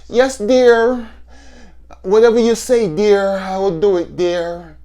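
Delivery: high, meek yes-dear voice; meek henpecked husband. Retold or imitated. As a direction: simper like a whipped husband. Man mocked as submissive husband.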